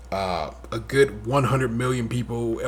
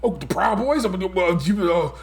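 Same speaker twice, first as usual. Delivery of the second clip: high, hesitant mock stammer; pitched-up bumbling imitation; stumbling Trump impression; 5 semitones higher than usual. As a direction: fumble the words like a flustered Trump. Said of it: "he's just stumbling over his words".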